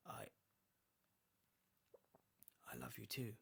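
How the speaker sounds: soft, hoarse scratchy murmur; hoarse mutter. A narrator's reading. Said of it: "muttered the man in a hoarse, scratching voice".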